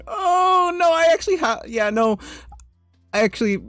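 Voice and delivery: high, loud quick protest; surprised protest. Real, not acted. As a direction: cut in surprised. Reacting to chat.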